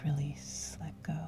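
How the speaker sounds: normal talking voice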